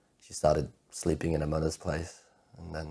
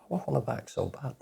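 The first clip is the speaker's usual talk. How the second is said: high, aching plea; heartbroken longing; 6 semitones higher, 4 dB quieter than usual. Acted. Man wanting his ex back, heartbroken. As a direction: let the ache push the voice up. Heartbroken confession of missing her.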